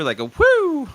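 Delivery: high, loud gliding whoo; roller coaster whoo. Shown as a demonstration. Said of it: "a little roller coaster".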